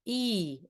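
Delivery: e with the tongue high against the palate; high e vowel. Shown as a demonstration. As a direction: ring the e forward. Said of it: "So he".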